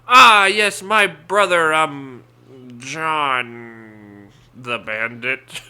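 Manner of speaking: put-on sly old-time con man; oily carnival swindler, drawn out; old-timey swindler voice. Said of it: "your old-timey, like, swindler voice".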